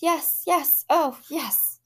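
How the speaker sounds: high, tearful frantic yes. A narrator's reading. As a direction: answer frantically, near tears. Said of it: "a frantic, watery-eyed nodding".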